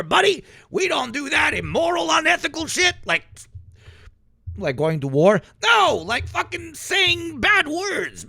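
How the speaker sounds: mock pious patriot. Mocks a pious American patriot.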